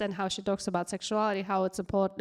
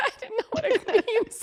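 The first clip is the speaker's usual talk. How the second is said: laughing. Real, not acted. Laughing at own ignorance.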